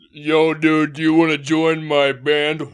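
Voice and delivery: slow warped stoner voice; deep gas voice. Pretend. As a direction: talk like you just huffed duster. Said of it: "It makes it deeper".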